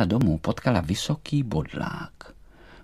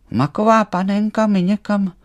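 high, loud alarmed butterfly; high-pitched dismayed exclamation; startled; 11 semitones higher, 5 dB louder than usual. A narrator's reading. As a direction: call out in fright, stretching the last word. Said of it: "lekl se Emanuel".

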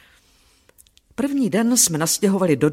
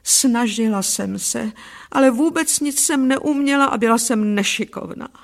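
plain reading voice

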